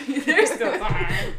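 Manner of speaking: slow, high, laughing repeat; giggling through it; laughing echo. Real, not acted. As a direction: laugh the words out. Laughing repeat of sister's line.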